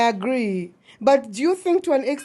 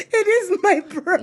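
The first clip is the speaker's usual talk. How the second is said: laughing confession; 6 semitones higher, 3 dB louder than usual. Real, not acted.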